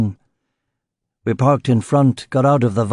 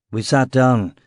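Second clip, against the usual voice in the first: ordinary delivery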